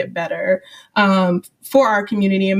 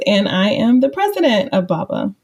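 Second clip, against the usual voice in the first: ordinary talk, nothing marked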